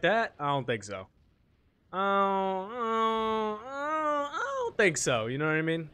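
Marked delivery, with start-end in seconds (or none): 1.9-5.3 whiny fake sob, rising higher; mock crying. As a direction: fake cry the denial.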